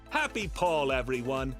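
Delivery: ordinary talk, nothing marked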